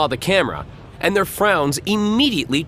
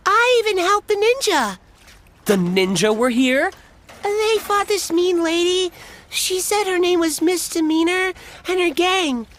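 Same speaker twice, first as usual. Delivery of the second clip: put-on much higher eager kid voice; little boy voice. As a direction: tell it like a proud little boy.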